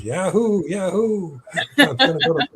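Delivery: high, whooping yahoo with a laugh; excited yahoo. Retold or imitated. Boy whoops hearing the family is moving to Hawaii, high and laughing. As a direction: cheer it high like a thrilled teen. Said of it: "And I was".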